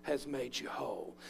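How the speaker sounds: Jesus blesses the healed woman, soft and tender; tender blessing. Acted. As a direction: lay it gently on her like a blessing.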